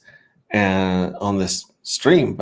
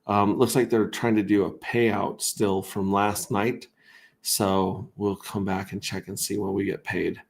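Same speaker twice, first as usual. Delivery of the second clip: ordinary talk, nothing marked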